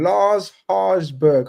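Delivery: Lars Harsberg said very slowly, each syllable spelled out; careful name pronunciation. Shown as a demonstration. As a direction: take your time over Lars Harsberg, slowly. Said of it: "Hopefully I've said that".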